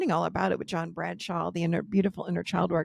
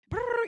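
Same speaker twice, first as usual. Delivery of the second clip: mock growl. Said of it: "he'd be like".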